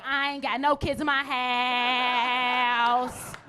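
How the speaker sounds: drawn-out, held last word; jubilant porch bellow. Acted. Mother to neighbors with kids gone, drawn-out crowing. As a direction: bellow it slowly to the whole street.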